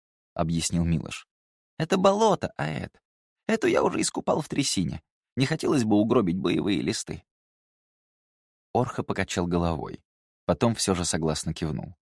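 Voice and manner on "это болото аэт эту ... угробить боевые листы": lightly voiced young man; a higher, lively character voice. A narrator's reading. Milosh explains map.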